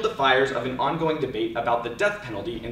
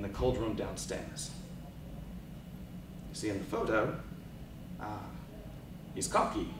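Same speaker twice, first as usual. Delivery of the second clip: spoken word poetry. Acted. Poet telling the audience about the morgue, hushed spoken word. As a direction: let the images land in hushed pauses.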